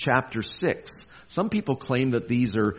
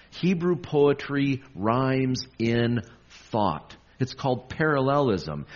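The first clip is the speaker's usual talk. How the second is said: ordinary talk, nothing marked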